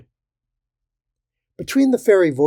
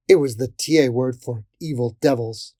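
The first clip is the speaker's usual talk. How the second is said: usual speaking voice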